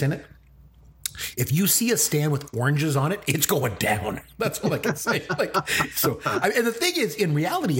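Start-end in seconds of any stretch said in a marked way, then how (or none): 4.4-6.2 laughing. Real, not acted. Laughing at own pet peeve. 6.3-7.8 excited. Real, not acted. Excited rant.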